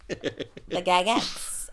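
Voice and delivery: the gagettes stretched out very slowly, each sound drawn long; savoring drawl. Real, not acted. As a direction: linger on gagettes like tasting it. Savoring the new joke word.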